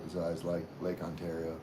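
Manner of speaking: ordinary talk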